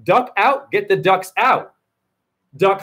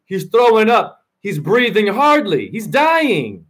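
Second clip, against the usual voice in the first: usual speaking voice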